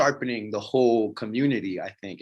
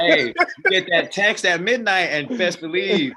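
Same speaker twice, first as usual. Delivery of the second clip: laughing; 7 semitones higher, 4 dB louder, slower than usual. Real, not acted. Laughing story of ex text.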